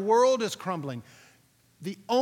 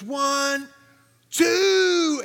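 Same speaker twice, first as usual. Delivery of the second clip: louder and higher on each number, two is the biggest; shouted countdown; 10 semitones higher, 11 dB louder than usual. Retold or imitated. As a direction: count off the jump, yelling over the wind. Instructor counting before the jump.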